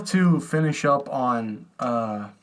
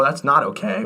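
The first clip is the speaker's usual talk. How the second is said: ordinary talk, nothing marked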